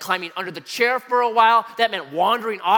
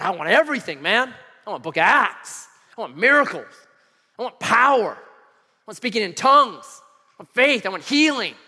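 emphatic, building list of demands; Pentecostal fervor; 3 semitones higher, 5 dB louder than usual. Retold or imitated. Student telling campus mentor his wishes, Pentecostal fervor. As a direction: punch each wish louder as it builds. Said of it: "I just go Pentecostal".

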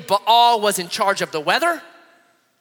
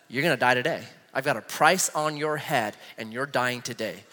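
low, softer threat; lightly voiced villain, pitched down and quiet; hint of wicked queen; 8 semitones lower, 6 dB quieter than usual. Wicked queen Jezebel threatening Elijah, understated menace. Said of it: "the wicked Queen Jezebel".

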